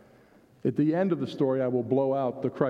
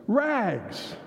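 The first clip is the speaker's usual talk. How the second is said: ringing, higher street cry; clear tenor call; 8 semitones higher than usual. Acted. Ragman calling through city alleys, ringing tenor cry. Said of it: "he called out in a clear tenor voice".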